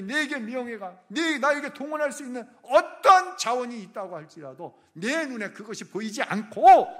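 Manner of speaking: high, loud fervent preaching; preaching at full force. Acted. Pastor urging the congregation toward God alone, fervent preaching. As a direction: drive it home with all your fire.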